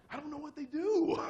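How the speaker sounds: high, loud, clueless wail; comic helplessness. Retold or imitated. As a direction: plead ignorance high and loud. Comic helplessness as fake investigator.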